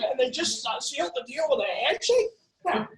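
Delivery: normal talking voice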